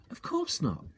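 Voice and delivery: put-on high young boy's voice; lightly voiced boy Danny; child's voice. A narrator's reading. Danny voiced in read story.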